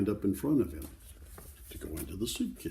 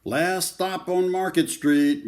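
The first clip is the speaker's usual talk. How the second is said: louder, higher bus-driver call; 11 semitones higher, 11 dB louder, slower than usual. Acted. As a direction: announce the stop to the whole bus. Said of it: "Mister Dennis called".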